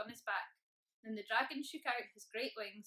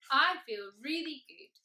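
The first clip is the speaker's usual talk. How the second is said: loud, raised, happy dragon voice; cheerful boom; 4 semitones higher, 11 dB louder than usual. A narrator's reading. Happy dragon after a day of good deeds, booming cheerfully. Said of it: "he boomed cheerfully".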